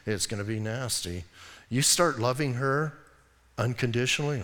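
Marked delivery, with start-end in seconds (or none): 0.0-1.2 low, soft, taunting devil; a low sly tempter murmuring doubts; preacher voicing the devil, low and sly; mock devil voice. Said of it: "here's what the devil says".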